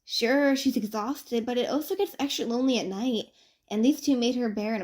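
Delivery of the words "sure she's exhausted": plain reading voice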